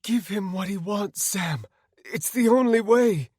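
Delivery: high, desperate woman's plea; high-pitched, rising desperation; pleading. Acted. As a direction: beg him to give in. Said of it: "Anna pleaded".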